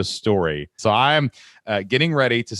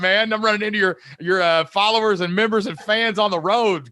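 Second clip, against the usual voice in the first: excited praise; 10 semitones higher than usual. Real, not acted.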